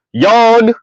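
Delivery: mock yawn. Mock bored reaction to flattery.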